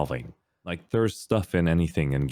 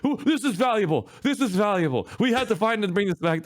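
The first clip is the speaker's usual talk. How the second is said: greedy excited brother. Retold or imitated. Brother thrilled by the kid's gold. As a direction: gloat over the treasure. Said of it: "He's like".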